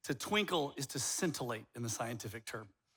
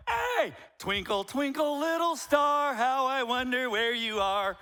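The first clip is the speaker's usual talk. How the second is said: much higher, louder and slower, full of energy; lively fun delivery; 9 semitones higher, 7 dB louder, slower than usual. Shown as a demonstration. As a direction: sell it like a fun preacher. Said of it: "When I just come up here and I'm like".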